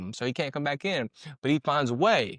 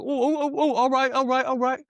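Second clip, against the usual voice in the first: mock panicked squeal, much higher, hammed-up; panicked giving in; 10 semitones higher, 3 dB louder than usual. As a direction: suddenly lose all the tough act and beg off. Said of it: "then you're like".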